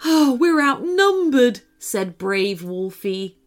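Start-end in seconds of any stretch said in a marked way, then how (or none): 0.0-1.6 high, dismayed little cry; raised-pitch lament from a small hero. Acted. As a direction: voice the worry of being outnumbered. Brave Wolfie laments being outnumbered.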